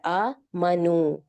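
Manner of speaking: आ मनू very slow, with आ held long and मा clipped short. Shown as a demonstration. Teacher contrasts the long आ with the short मा. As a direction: stretch the आ, keep the मा of मनू short. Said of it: "मनू की आवाज आएगी".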